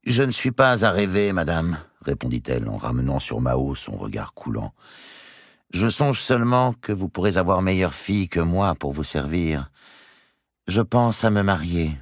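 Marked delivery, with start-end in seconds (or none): 0.1-1.8 slightly high, composed servant's reply; lady-in-waiting answering back. Acted. Béatrice answers the countess. 5.7-12.0 lightly raised pitch, composed servant voice; slyly provoking lady-in-waiting. Acted. Béatrice baits the countess.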